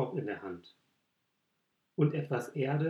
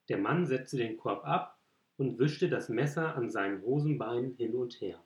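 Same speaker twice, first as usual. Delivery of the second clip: plain reading voice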